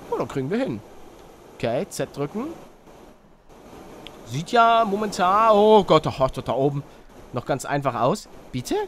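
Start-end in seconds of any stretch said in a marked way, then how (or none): none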